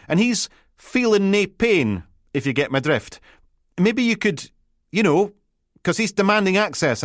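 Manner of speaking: flustered Peterhead lass fumbling for the right title; a Peterhead constable calling her boss on the radio, flustered; Peterhead accent. Acted. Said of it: "A Peterhead accent".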